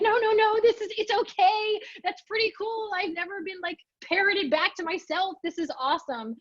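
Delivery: smooth it over for her gently; reassuring. Retold or imitated. Reassuring embarrassed Cheryl at the bar, high and light.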